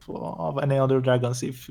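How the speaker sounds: usual speaking voice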